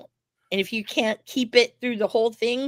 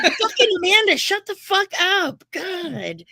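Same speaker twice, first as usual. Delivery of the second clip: high, louder hammed-up irritation; mock exasperation; 10 semitones higher, 4 dB louder, slower than usual. Playing the annoyed wrestler telling off a friend, mock outburst. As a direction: stretch it out, acting fed up. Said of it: "He's like".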